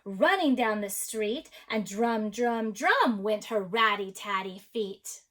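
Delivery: animated, rhythmic storybook narration; story read-aloud. A narrator's reading. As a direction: read to children with big swings of pitch. Reads a picture book aloud.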